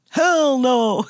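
blunt Southern drawl brushing it off. Retold or imitated. Southern producer scoffing at an interviewer, Southern drawl. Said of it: "he said in that southern drawl".

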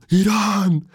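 high, drawn-out mock yell; imitated full-out shout. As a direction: mimic a street shouter, stretching the word high. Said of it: "Φουλ δυνατά".

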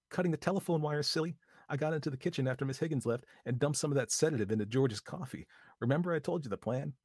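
Lucy's voice. A narrator's reading. Lucy telling Vi the plan, a lightly voiced young woman.

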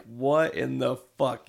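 slow, baffled what-the-heck; dragged-out confusion; stunned disbelief. Retold or imitated. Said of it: "he's like".

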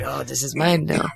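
mock French raccoon. A French-sounding raccoon claims the sweatshirt, light mock accent. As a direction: purr it like a smug Frenchman. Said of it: "Oh, he's French".

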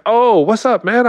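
high-pitched, delighted hello; excited greeting. Retold or imitated. Director greeting him at the door, excited. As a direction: voice leaps up in a retold greeting.

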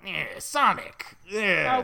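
slow, high, loud cartoon villain; Eggman voice. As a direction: ham up the mad doctor. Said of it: "can you help me out with that".

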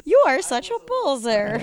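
happy Russian poser line; upbeat. Pretend. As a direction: say it like she's happy about it. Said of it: "a little more upbeat about it".

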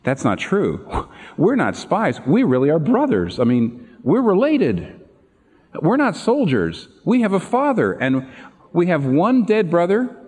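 begging brothers, mildly hammed up; pleading brothers. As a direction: protest your innocence, voice climbing. Brothers pleading before Joseph.

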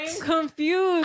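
slow, drawn-out confused whine; mock confused. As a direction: play dumb, stretch it out.

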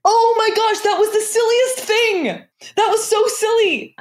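high, giddy exclaiming; tickled by how silly it was; giddy amusement. Real, not acted. Amused at dummy falling from tower.

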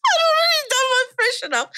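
high, squealing through laughter; helpless laughter. Real, not acted. Storyteller confessing about her discarded knickers, helpless laughter. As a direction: wail it out while really laughing.

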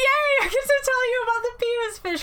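high gleeful squeal; mock excited. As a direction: gush with fake glee. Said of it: "I'm so excited".

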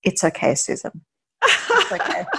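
playful mock-soothing that breaks into a laugh; naughty teasing. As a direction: tease her gently, echoing her words while laughing. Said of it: "with this very naughty look on his face".

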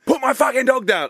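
high indignant yell; mock angry yell. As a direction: play the outraged dog owner yelling. Angry dog owners retort.

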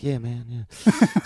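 slow, stretched-out easygoing agreement; a lightly voiced, unhurried shrug of a reply; laid-back drawl. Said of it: "he's like".

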